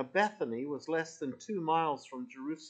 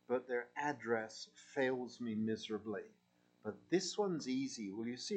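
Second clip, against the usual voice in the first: ordinary talk, nothing marked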